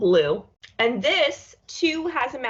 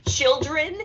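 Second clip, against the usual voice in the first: mock stern call to the kids, louder and higher; aggressive call; 6 semitones higher, 4 dB louder than usual. Mock aggressive parent calling the children. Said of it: "just a very aggressive".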